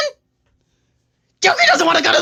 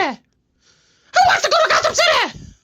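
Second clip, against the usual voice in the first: manic taunt; 12 semitones higher than usual. Acted. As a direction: stretch it out high like a crazed Joker. Joker repeats the taunt.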